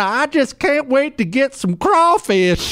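high, slow mock Louisiana country drawl; John Kennedy bumpkin. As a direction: ham up a folksy Louisiana senator. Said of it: "that funny country bumpkin thing".

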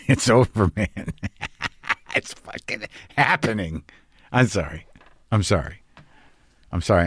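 soft laughter breaking through tears; laughing helplessly while tearing up; crying and laughing. Real, not acted. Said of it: "I'm crying and laughing at the same time".

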